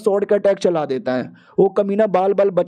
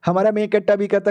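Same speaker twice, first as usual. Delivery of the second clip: ordinary delivery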